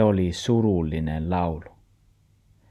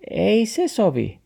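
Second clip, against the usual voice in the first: high dog voice; 13 semitones higher than usual. Acted. The dog refuses grandpa, in a high voice.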